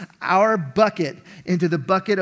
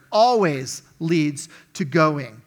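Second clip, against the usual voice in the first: always drawn out slow and leaned on hard; slow, heavy stress on always; 2 semitones lower, 4 dB louder, much slower than usual. Shown as a demonstration. Preacher to church, always stressed slow and heavy. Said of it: "I'm gonna emphasize that word".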